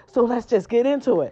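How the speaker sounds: ordinary talk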